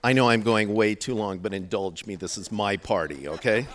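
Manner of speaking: projecting over the room; loud over crowd. Real, not acted. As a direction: raise your voice over laughter. Calling over laughing crowd.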